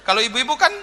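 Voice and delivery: high, laughing punchline; loud, amused laugh through the words. Real, not acted. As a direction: tell it while cracking up. Joke continues laughing.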